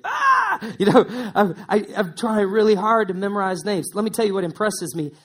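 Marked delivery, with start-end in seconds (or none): 0.0-0.7 sudden, much higher yelp; high startled ah; embarrassed yelp. Pretend. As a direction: yelp as if caught out. Caught forgetting a name.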